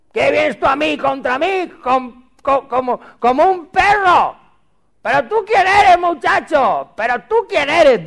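high, loud, outraged taunting; hammed-up bellow of contempt; scornful giant. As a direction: jeer at a puny challenger. Acts out giant mocking young David.

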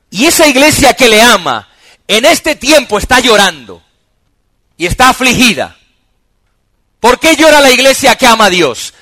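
loud, high preaching, every phrase driven hard; full-force preaching. Real, not acted. Pastor preaching to the congregation, loud and high. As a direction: preach it out to the whole church.

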